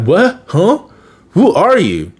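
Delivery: a mock jolt of surprise at meeting yourself; confused yelp of surprise; startled Ben. Ben bumps into his double.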